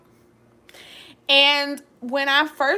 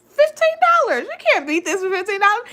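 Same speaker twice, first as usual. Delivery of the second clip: excited; 7 semitones higher, much faster than usual. Real, not acted. Excited about cheap ring price.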